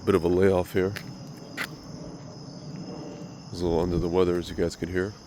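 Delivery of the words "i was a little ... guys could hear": stuffy nose from a cold; talking through a blocked nose; nasal voice. Real, not acted. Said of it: "My nasal voice".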